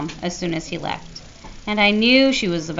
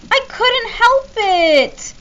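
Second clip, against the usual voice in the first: put-on much higher, slow childish whine; whining little boy; 7 dB louder, much slower than usual. A narrator's reading. Jamie excusing himself to Mom, whining. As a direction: stretch it out like a whiny kid. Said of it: "kind of whining".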